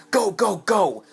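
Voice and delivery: high, loud re-enacted yell; urgent yell. Teller urging the guys out the door, yelling. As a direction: yell them through the door. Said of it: "I yelled at the guys".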